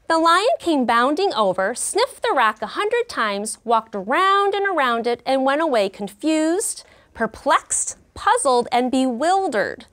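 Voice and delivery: reading the story with slow, dramatic emphasis; expressive read-aloud. Acted. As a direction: bring the lion's confusion to life for the class. Teacher reads story aloud.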